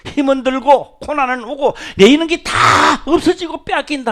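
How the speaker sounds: building preaching. Real, not acted. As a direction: drive it home.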